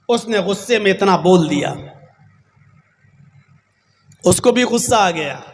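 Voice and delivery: normal talking voice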